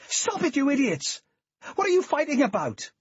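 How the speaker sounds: loud, high shout; desperate shout. A narrator's reading. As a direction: scream at them to stop. Jane breaks up fight.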